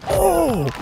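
long high-pitched "oh"; high startled cry. Real, not acted. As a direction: yelp in shock. Hit in game.

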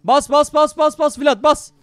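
urgent urging. Real, not acted. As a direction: spur a teammate on frantically. Urging teammate to engage. (heyecanlı, ısrarlı bağırış)

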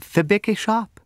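timidly, high and trembling. A narrator's reading. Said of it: "trembling very much".

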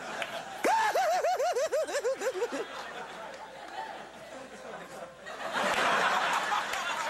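long, high manic babbling noise; hammed-up lunatic noise, no words; crazy California impression. Host mimicking a crazy state for the audience, manic wordless noise. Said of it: "That's my impression of the state of California".

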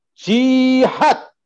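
loud, high, burning "Jihad" with a rise; fiery declaration. Real, not acted. Speaker closing his vow to the host, loud and fiery. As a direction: proclaim it with fire. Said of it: "luar biasa membara".

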